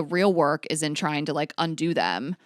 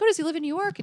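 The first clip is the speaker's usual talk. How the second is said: much higher, falsely innocent question; playing dumb; 11 semitones higher, faster than usual. Playing dumb with the boyfriend over text, higher innocent voice. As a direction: ask it sweetly, pretending not to know. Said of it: "Like, just play dumb".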